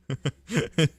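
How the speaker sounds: short, high burst of laughter. Real, not acted. Streamer reacting to chat, short high laugh. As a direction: crack up briefly.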